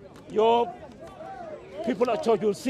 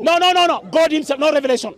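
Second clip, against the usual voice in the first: loud protest; 4 semitones higher, 6 dB louder than usual. Real, not acted. Cutting off opponent in debate.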